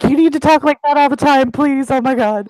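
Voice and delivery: high, loud, thrilled squealing plea; delighted squeal. Acted. Squealing at the High King to keep his accent, high and loud. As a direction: squeal it out like she can't contain herself. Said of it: "Squeals and is like".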